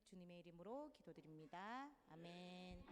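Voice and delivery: hushed, even spoken prayer. Real, not acted. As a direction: pray quietly and reverently for everyone's safety. Closes the prayer.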